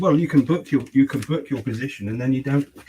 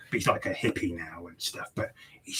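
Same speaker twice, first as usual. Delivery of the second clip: whispered aside; 6 semitones lower, 9 dB quieter than usual. Real, not acted. Whispered aside in film retelling.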